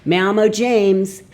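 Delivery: high, loud, slow mangled Spanish; bad Spanish accent. Shown as a demonstration. Learner introducing himself in badly accented Spanish. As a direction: overdo a clumsy English accent in Spanish.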